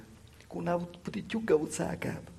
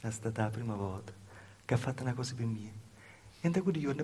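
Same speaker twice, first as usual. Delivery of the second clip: normal talking voice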